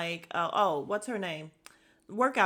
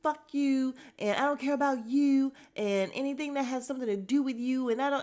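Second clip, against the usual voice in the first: raised-pitch, louder mock tirade; imitation of a man going off; mimicking him losing it; 6 semitones higher, 3 dB louder than usual. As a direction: act out his meltdown, pitched up and pressing on. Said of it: "he really was losing his shit".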